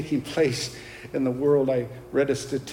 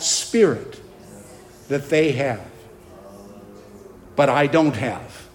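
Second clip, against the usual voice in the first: loud, emphatic righteous indignation; real anger; 4 dB louder than usual. Real, not acted. Preacher denouncing deceived doctors to his congregation, angry. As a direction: preach it with forceful, heavily punched key words. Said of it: "I'm angry".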